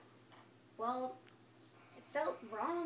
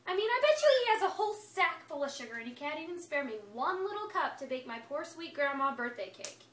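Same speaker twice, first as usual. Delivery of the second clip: plain reading voice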